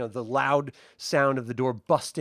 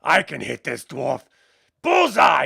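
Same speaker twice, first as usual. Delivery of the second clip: cocky orc captain; 6 dB louder than usual. Acted. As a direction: gloat as the arrow flies. Captain aims and shoots.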